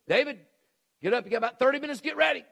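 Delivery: commanding shout to a sleeping son; daddy calling out. Retold or imitated. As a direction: imitate a father hollering into a bedroom. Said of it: "what my daddy would have done".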